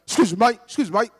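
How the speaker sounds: restless, repetitive Cockney geezer; Cockney accent. Acted. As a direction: pester like a jittery Cockney. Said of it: "I put on the same accent".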